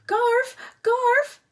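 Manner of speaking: high, loud call across the room. A narrator's reading. Lena calling Garf, high and loud. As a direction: yell his name to fetch him. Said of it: "called Lena".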